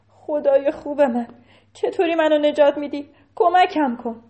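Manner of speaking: pleading moan. Acted. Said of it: "ناله کرد و گفت".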